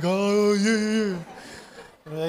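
put-on high-schooler voice; imitating his teenage self. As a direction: play a dopey teen taking the dare. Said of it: "that's my high school voice".

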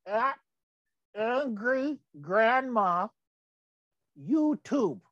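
slow, careful Angry Grandma; deliberate dictation of the handle; slowly spelled handle. Shown as a demonstration. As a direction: say it slowly so viewers can type it. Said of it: "put the little at sign first".

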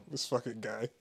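ordinary talk, nothing marked

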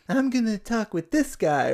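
put-on high girly voice. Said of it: "And she's like".

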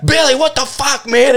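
high, laughing imitation of an outraged bartender; hammed-up high bartender outburst; mock bartender yell. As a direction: squeal it like the stunned bartender. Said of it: "the bartender was like".

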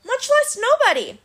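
high-pitched mocking jab; lilting, provoking taunt. A narrator's reading. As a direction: goad the monster, voice lifted high. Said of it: "Annabeth taunted".

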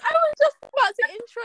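frustrated shout. Real, not acted. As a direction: yell out in exasperation. Frustrated cry after being cut off.

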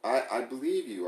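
usual speaking voice

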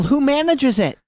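high outraged squeal; entitled customer. Acted. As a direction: shriek like an offended customer. Mock outrage at console.

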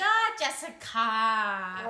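put-on slow, dragged Thai accent. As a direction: drag out "Ah, Jessica" Thai style. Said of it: "Thai people drag".

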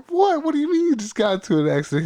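a high startled what, then fast; shocked disbelief. Retold or imitated. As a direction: blurt it out in alarm, fast. Shocked on hearing brother's crash.

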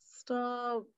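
soft, weary "stop"; resigned brush-off. Retold or imitated. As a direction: say it quietly, no fight left. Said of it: "he's used to my, my harassment".

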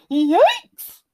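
high, loud startled screech. A narrator's reading. Goldilocks wakes to see the bears, high screech. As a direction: screech it in alarm. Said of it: "she screeched".

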